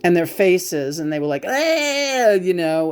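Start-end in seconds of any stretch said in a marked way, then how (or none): 1.5-2.3 imitated pained scream. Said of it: "sounding like my father-in-law when he's screaming".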